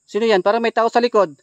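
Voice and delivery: loud alarmed cry, someone's behind; startled shout. Real, not acted. Gamer startled by a figure behind him, loud alarmed cry. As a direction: blurt it out loud in fright.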